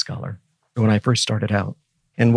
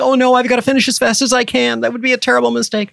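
much higher, louder, anxious rush; mock panicked student; 13 semitones higher, 3 dB louder than usual. Professor mimicking students panicking to graduate, high and anxious. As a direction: play the frantic student racing to finish. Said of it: "And people think".

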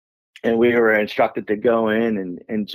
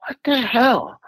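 surprised; slower than usual. Retold or imitated. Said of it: "And I'm like".

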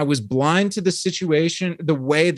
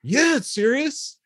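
much higher, slow mock-serious answer; mock solemn reply; 10 semitones higher, slower than usual. Answering confused viewers, in a high, slow mock-serious voice. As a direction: play it up as a knowing deadpan, pitched high. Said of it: "And I was like".

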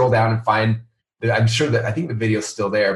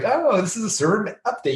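higher, breezy fake-reassuring tone; mock corporate spin; 10 semitones higher than usual. Mocking companies that sugarcoat outages, breezy. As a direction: sugarcoat it like a press release. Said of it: "like most companies do".

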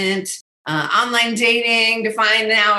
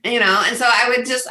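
usual speaking voice

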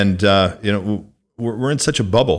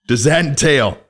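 slow, drawn-out, playfully grave ask; milk the suspense of the big question; mock-dramatic question; 7 semitones higher than usual. Host teasing guest with a big question, mock-dramatic.

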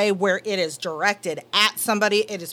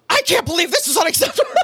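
higher, fast, half-crying mock rant; mock angry outburst; 7 semitones higher, faster than usual. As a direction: vent at the phone agent in a hammed-up upset burst. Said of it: "So I'm gonna sound really pissed and harsh".

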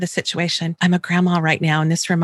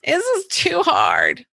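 put-on whiny child; 8 semitones higher, slower than usual. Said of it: "my little phrase".

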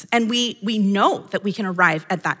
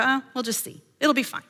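a softer, shrugging mock of carelessness; mock apathetic; 5 dB quieter than usual. Apathetic woman brushing off the warning, softer shrugging mock. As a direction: toss it off as if nothing matters. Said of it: "be apathetic and go".

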